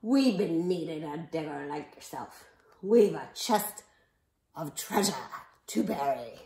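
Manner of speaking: hammed-up storybook sea dog; Braidbeard. A narrator's reading. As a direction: announce yourself like a pirate chief. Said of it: "Braidbeard told me".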